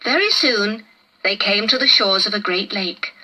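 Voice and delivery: plain reading voice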